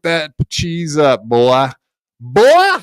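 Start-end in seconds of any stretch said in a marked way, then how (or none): 2.2-2.8 loud, high jeering shout; taunting yell. Real, not acted. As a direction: bark it at him like a taunt. Taunting the rival on stream.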